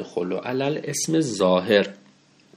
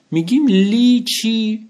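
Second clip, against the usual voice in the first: rhetorical question; 12 semitones higher, 6 dB louder, slower than usual. Shown as a demonstration. Teacher asks rhetorical question.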